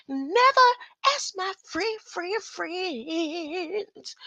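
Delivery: high put-on silly voice; high squeaky parody, trailing off; mock voice. Continues mock voice.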